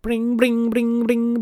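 high, sing-song mock ringing; piercing imitated ring; imitating a phone ringtone. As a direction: chirp it like an alarm tone. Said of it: "it's ringing in my face, like".

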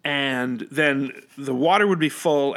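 ordinary talk, nothing marked

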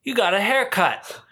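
imitating grandpa. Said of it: "Grandpa looks".

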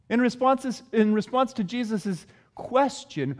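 ordinary talk

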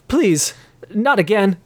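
frightened barkeep pleading; quivering. A narrator's reading. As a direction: beg him not to. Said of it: "the barkeep quivered".

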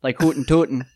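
badly researched Chicago guy voice; laughing, hammed-up Chicago accent; hokey Chicago accent. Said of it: "that just got Norwegian".

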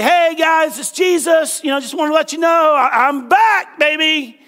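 hammed-up triumphant hello; playful announcement. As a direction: call it out like a jokey comeback, stretching "back". Acting Jesus announcing himself on doorbell camera.

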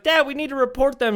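put-on high, urgent kid voice; imitated earnest child. Child urging cop dad to act, imitated high urgent plea. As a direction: plead up at a parent like a small boy.

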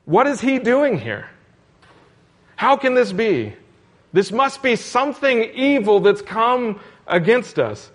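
a hint of frightened fishermen in the storm; mock fearful disciples. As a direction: voice the disciples' panic, lightly. Terrified disciples voiced in retelling.

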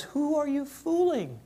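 Spirit's voice. Acted. Spirit's voice in sermon story.

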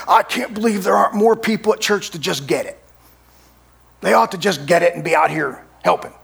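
a touch of indignant volunteer; lightly voiced annoyed helper; mild mock grumble. Shorthanded tree-lot volunteer complaining about church, mock grumbling. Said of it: "And he was mad".

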